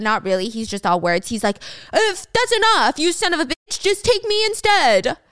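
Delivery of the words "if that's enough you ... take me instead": high, loud acted outburst; desperate shouting. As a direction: shout a pitched-up demand, dragging the last words. June desperately confronts the kidnapper.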